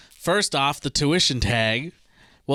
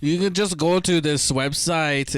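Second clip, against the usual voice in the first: put-on German accent; 2 semitones higher, slower than usual. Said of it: "I don't know if I can do a German accent".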